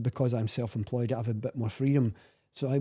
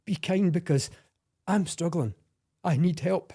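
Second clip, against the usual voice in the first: choked up; 5 semitones higher, 4 dB louder than usual. Real, not acted. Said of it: "I'm breaking up here".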